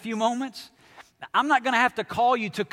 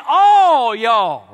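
loud, broad Alabama "All y'all"; Southern all y'all; 3 semitones higher, 14 dB louder than usual. Shown as a demonstration. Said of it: "I might even say".

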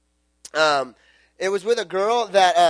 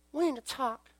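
put-on serious girlfriend; 6 semitones higher, 12 dB quieter than usual. Girlfriend calls, serious breakup.

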